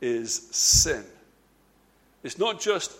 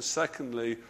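usual speaking voice

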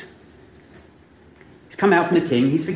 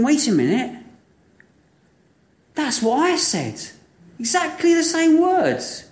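higher, slow astonished recognition; dawning realisation; 10 semitones higher than usual. Acted. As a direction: let the penny drop slowly. Servant realising own words.

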